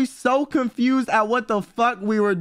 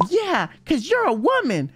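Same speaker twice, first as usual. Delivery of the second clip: lifted higher, lightly mimicking; a light higher-pitched imitation; higher replay; 6 semitones higher than usual. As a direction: replay her line in a higher voice, amused. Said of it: "She was like".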